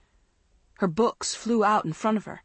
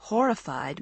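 plain reading voice

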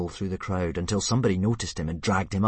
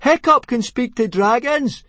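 much higher, slow, very loud shout; shouted very loudly; 12 dB louder than usual. Acted. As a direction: shout it across the whole crowd. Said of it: "shouted Fishlegs very loudly indeed".

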